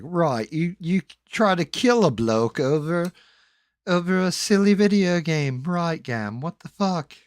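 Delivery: high, loud British scolding; loud mock British telling-off; British accent. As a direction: tell him off like a British mate. British friend scolds the narrator.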